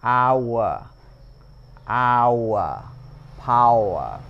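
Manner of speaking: "our" and "power" with a clear a-u glide; model our and power. Shown as a demonstration. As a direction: repeat "power" slowly as a model word. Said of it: "giống như từ power". (đọc mẫu "power", lướt rõ a sang u)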